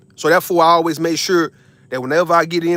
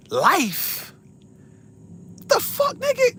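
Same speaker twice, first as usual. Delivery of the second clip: outraged disbelief; 5 dB quieter, much slower than usual. Retold or imitated. In the courtroom, hearing life asked for, outraged disbelief. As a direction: jump way up, can't believe it. Retelling reaction to life sentence.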